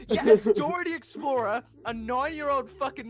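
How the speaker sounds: shocked yell. Real, not acted. Friend finds shocking image.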